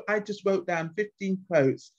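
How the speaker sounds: ordinary delivery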